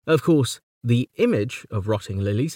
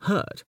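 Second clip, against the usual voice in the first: "hurt" given a strong beat, pitched up; stressed hurt; 9 semitones higher, 4 dB quieter than usual. Shown as a demonstration. Lecturer shows the strong beat on "hurt". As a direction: punch "hurt". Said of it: "equalled by the next stress".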